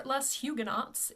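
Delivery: "les Huguenots" read English-style with H and final TS; Anglicised pronunciation. Shown as a demonstration. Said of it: "The correct pronunciation for".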